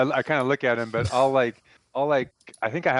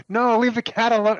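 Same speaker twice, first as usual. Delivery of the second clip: high, loud yell to stop a threat; 12 semitones higher, 5 dB louder than usual. Pretend. Player yelling to stop the thug from hurting the cat, high loud call.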